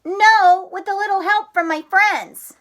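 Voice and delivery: put-on high, loud eager frog kid; excited Froggy. Froggy telling Mom about his treehouse plan, high and loud. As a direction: chatter like a thrilled little frog.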